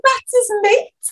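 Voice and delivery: high, slow, incredulous squeal; appalled realisation. Retold or imitated. Realising the officer is mates with far right, appalled. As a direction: draw it out high in retold disbelief.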